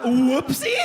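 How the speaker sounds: high, loud disbelieving question; incredulous echo. Retold or imitated. Splattered husband echoing the doctor, incredulous. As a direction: throw the word back, appalled, rising sharply.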